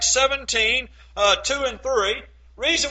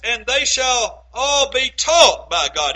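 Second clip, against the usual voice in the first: slow down and hit "taught" hard; "taught" punched louder, drawn out; stress on taught; 2 semitones higher, 6 dB louder, slower than usual. Real, not acted. Preacher stressing the key word of the verse, "taught" emphasized. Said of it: "Emphasize that word taught".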